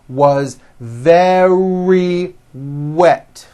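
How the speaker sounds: very with a clear V and a strong R, slow. Shown as a demonstration. Teacher repeating very for learners. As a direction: land the V then roll into the R. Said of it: "very starts with a V sound followed by a strong R".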